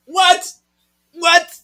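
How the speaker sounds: very high shocked what; shocked squeal. Real, not acted. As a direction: gasp it out in disbelief. Shocked reaction to reveal.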